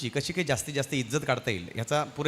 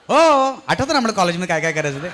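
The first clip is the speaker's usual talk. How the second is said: put-on teasing girlfriend voice; 3 semitones higher, 8 dB louder than usual. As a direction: squeal it like an eager old college friend. Friend insisting, laughing. (उंच, लाडिक, चिडवणारा मैत्रिणीचा आवाज)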